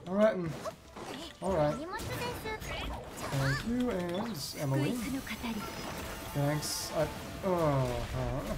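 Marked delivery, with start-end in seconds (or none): none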